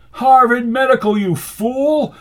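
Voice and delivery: high, loud, angry retort; snapped. A narrator's reading. Rasputin answers a slur on his credentials, snapping. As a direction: snap back at an insult. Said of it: "snapped Rasputin".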